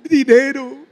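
loud hammed-up whine; mock whiner. Interpreter echoing the whiner, loud mock whine. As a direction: beg like a sulking child.

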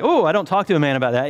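high, loud, hurried belle imitation; mock a scandalized Southern lady; ruffled Southern belle. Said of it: "one of these Southern Belle kind of lady".

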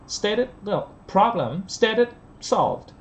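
high, stressed "stated" and "solved"; contrastive stress. Shown as a demonstration. Teacher drilling contrast, stated and solved stressed high. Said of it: "nhấn vào từ solve này là để đối lập".

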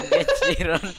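squealed high with a laugh; laughing taunt. Pretend. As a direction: crack up mid taunt. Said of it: "repeated taunt, pitched up laughing".

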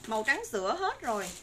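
normal talking voice

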